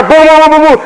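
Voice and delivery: rapid high booms of a volley; string of high-pitched mock gun booms; imitated gunfire. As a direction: rattle off musket shots with your voice.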